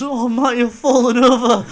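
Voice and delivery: put-on high, laughing drunk complaint; drunken whimper. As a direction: pathetic plea to his mate. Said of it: "He goes".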